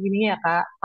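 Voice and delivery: ordinary talk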